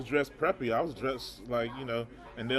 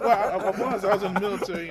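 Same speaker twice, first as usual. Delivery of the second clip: loud, higher-pitched, laughing protest; amused, raised-voice explanation; 6 semitones higher, 8 dB louder, faster than usual. Real, not acted. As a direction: talk through the laugh. Laughing amid his own story.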